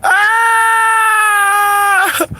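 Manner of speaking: laughing shriek. Real, not acted.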